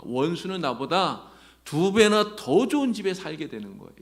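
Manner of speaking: ordinary delivery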